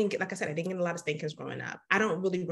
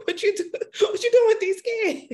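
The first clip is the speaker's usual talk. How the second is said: laughing mock elder; 7 dB louder than usual. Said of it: "they looking at me like".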